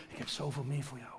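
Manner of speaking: hushed, low and soft invitation; tender Spirit voice. Retold or imitated. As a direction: whisper it like a gentle promise. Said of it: "de Heilige Geest ... zegt". (zachte, tedere fluistering)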